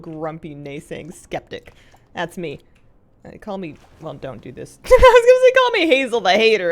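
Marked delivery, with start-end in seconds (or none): 4.8-6.7 laughing loudly, pitched up high; cracking up at her own joke name. Real, not acted. Streamer laughing at a self-made nickname, loud and high.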